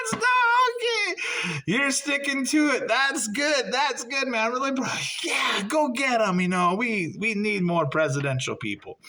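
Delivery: high, laughing, condescending encouragement; patronizing laugh. Retold or imitated. Laughing off the candidate's claim.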